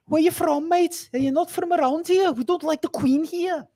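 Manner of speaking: high, hammed-up Liverpool accent; a second go at Scouse, pitched well up. Host retrying his Liverpool voice for the stream, hammed-up Scouse accent. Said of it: "That was better".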